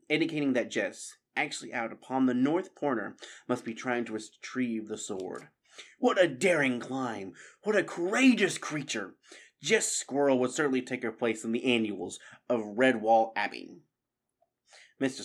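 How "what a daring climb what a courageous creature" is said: awed praise, rising higher; admiring exclamation. A narrator's reading. Admiring exclamation amid jubilation.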